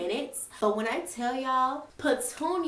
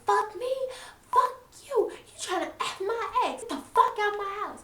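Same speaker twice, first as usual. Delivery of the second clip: higher, mock furious yell; angry retort; 10 semitones higher than usual. Petunia kicking Maria out, higher mock angry yell. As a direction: throw it back at her pitched up.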